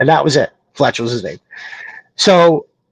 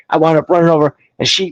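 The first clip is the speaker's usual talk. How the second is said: ordinary delivery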